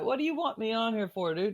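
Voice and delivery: high, disbelieving brush-off; incredulous retort. Retold or imitated. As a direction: push back like he can't believe the call. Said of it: "And so I'm like".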